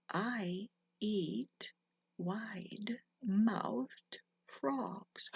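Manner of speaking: slow, soft, breathy menace; sinister owl whisper. A narrator's reading. Owl looking down, whispering menace to the frog. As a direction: whisper it slowly like a hungry owl.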